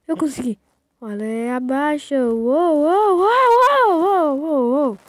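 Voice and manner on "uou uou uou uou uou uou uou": high, slow whooping; excited cheering. Real, not acted. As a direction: whoop in triumph. Cheering over the landing.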